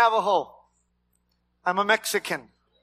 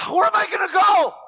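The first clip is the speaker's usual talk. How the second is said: shout; 12 semitones higher, 6 dB louder than usual. Real, not acted. Preacher's rhetorical outcry.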